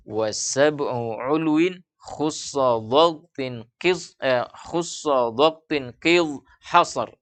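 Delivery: measured, drawn-out Arabic recitation; slow verse recitation. Shown as a demonstration. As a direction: recite the verse slowly, stretching each word.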